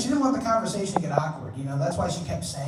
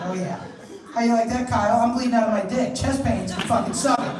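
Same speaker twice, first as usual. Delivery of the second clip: mock gloating kid; 5 semitones higher, 5 dB louder than usual.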